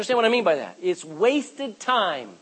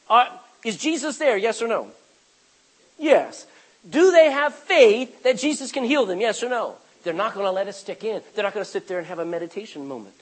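ordinary talk

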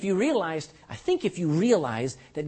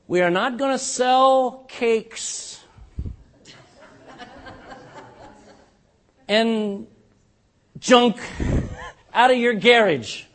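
slow, building louder refusal; emphatic; 4 semitones higher, 5 dB louder, slower than usual. Real, not acted. Pastor refusing bake sales to the congregation, slow and building loud. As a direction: lean into each word, slowly. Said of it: "building emphatic refusal".

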